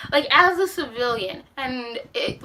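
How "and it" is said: ordinary talk, nothing marked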